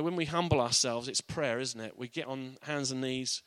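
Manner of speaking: usual speaking voice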